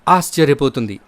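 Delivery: ordinary delivery, nothing marked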